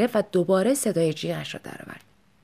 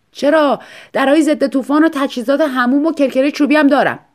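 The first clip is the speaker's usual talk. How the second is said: eager salesman; 6 semitones higher, 7 dB louder than usual. Acted. Salesman eagerly offers more.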